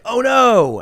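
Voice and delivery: sudden high alarmed cry; startled oh no; startled panic. Retold or imitated. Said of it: "you're like".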